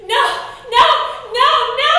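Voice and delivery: shouted no. Acted. Repeated shouted answers.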